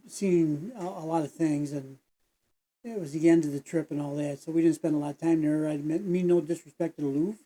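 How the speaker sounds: usual speaking voice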